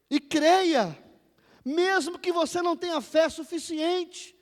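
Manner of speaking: loud, slow, drawn-out proclamation; thundering sermon exhortation; full-force preaching. Real, not acted. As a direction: proclaim each phrase with rising force.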